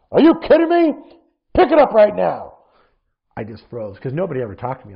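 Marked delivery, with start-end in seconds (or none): 0.1-2.5 very high, loud furious shout; angry coach yelling at a crying boy. Retold or imitated. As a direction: bark the kid back into line.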